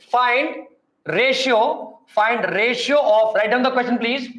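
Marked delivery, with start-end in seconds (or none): 0.1-3.3 slow dictation with drawn-out key words; dictating. Real, not acted. Teacher dictating a question for students to write down, slow dictation. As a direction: dictate it slowly, pausing for note-takers.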